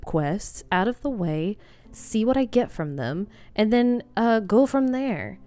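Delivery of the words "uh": ordinary talk, nothing marked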